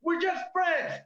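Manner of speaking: mock girl shouting. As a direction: shriek it like a startled girl friend-zoning him. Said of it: "They're like".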